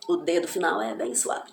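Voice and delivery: normal talking voice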